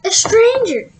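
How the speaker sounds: high, loud startled cry; a loud surprised cry from the hedgehog; startled gasp. Acted. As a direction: blurt it out in shock. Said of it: "Hedgehog gasped".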